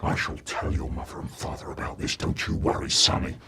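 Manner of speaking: low, hushed, menacing whisper; quiet threat. A narrator's reading. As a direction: threaten softly, almost breathless. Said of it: "all quiet, he said".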